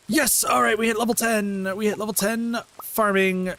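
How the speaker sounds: high, loud, thrilled shout of triumph; excited cheer. Real, not acted. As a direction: celebrate the win out loud. Hits level ten.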